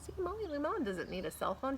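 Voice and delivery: ordinary delivery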